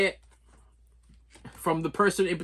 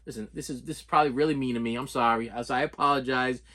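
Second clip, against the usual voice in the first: usual speaking voice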